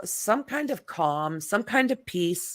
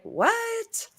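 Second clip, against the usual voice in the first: high, baffled "What?"; mock confused. Reacting to the bike race question, mock baffled. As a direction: play it clueless, way up high. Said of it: "It's like".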